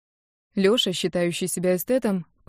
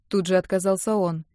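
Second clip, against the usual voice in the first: usual speaking voice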